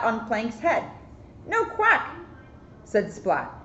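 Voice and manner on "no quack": character voice. Acted. Character voice in story.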